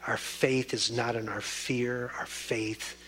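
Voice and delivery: hushed prayer sinking to a whisper. Acted. Closing prayer.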